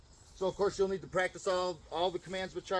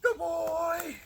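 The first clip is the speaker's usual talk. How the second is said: much higher, bright praise; high happy praise; 13 semitones higher, 4 dB louder than usual. Real, not acted. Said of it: "High and happy tone of voice".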